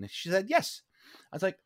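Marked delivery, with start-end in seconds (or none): 0.5-0.8 put-on high-pitched English-accented reply. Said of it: "in an English accent".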